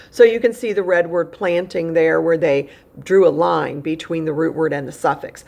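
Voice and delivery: ordinary talk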